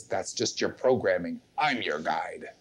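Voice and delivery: low, soft rival guide; another inner voice. Acted. A rival inner voice claims authority, low and dismissive. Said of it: "And then another voice goes".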